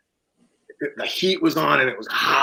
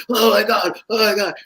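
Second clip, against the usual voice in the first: higher, panicky repeated gasp; sweating over shattered glass, panicked and higher; 8 semitones higher than usual. Retold or imitated. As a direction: panic at the damage.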